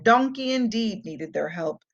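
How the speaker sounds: ordinary delivery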